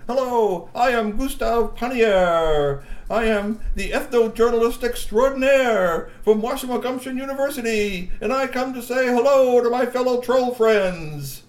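high, loud and slow grand introduction; pompous announcer voice. A narrator's reading. G P recording a greeting for the trolls, grand and loud. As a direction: present yourself grandly to the camera. G P performs for the camera.

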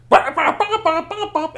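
very high, loud alarm bops. Acted. Narrator imitating alarm lights with loud high bops. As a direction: bop like a flashing alarm.

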